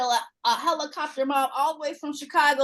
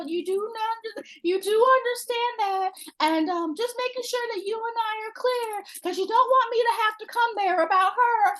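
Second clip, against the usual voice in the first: high, deliberate mock-threatening mom; stern mom warning; 8 semitones higher than usual. Mom warning the boyfriend to care for her daughter, high and stern. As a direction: lay down the law in a high sweet voice. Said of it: "she was like".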